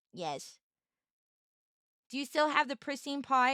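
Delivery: usual speaking voice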